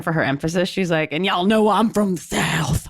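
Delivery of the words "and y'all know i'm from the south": mock Southern twang; thick Southern accent. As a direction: lay on the Southern accent for emphasis. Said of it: "she slides into a real thick Southern accent".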